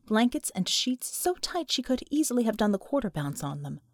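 normal talking voice

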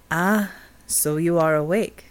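slow, measured Jamaican voice; Jamaican accent. A narrator's reading. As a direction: speak with an unhurried Jamaican lilt. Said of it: "Jacob Stone had a Jamaican accent".

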